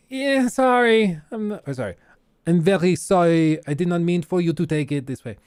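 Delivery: badly faked French accent; mock apology in a bad French accent; terrible French accent. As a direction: put on a clumsy French accent. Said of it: "that was a terrible French accent".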